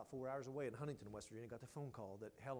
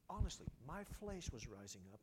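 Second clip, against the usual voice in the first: usual speaking voice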